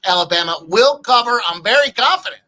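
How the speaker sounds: loud, emphatic read-out of a bold prediction; very confident. Retold or imitated. Reading a confident chat comment to co-hosts, loud and emphatic. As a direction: belt it out, rising in pitch with total certainty. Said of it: "very confident in Alabama".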